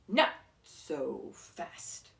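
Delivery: slow, menacing witch's hiss. A narrator's reading. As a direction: stretch it out, cold and threatening. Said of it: "hissed an unpleasant voice".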